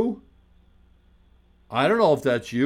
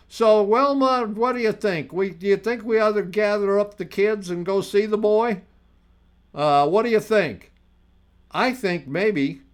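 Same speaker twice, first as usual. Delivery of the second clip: put-on old country codger; 5 semitones higher than usual. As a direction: ham up a folksy old man calling to his wife. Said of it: "I can do that one really well".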